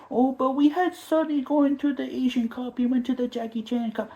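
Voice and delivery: high-pitched whiny mock excuse; mocking whiny fan. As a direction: whine it like a sulking fan. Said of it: "mocking Spurs fans' excuse".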